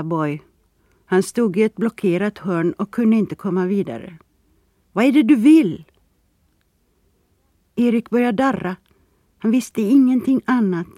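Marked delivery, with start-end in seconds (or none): 4.9-5.8 raised, high, loud question; alarmed demand. A narrator's reading. Boy cornered by Erik, loud alarmed demand. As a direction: demand an answer from an attacker.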